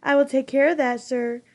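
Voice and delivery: sneering imitation of a dutiful servant; cruel sarcastic mimicry. Jonas mocking the giver's obedient servant, cruelly sarcastic. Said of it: "Jonas mimicked in a cruel, sarcastic voice".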